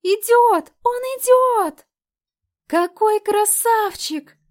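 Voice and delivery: high shrieking fangirl squeal. A narrator's reading. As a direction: squeal like a starstruck teen fan. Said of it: "завизжали девчонки".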